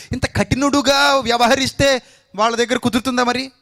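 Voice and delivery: high, loud impassioned sermon; fiery preaching. Acted. Preacher challenging the congregation, high and loud. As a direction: drive the point home with fire.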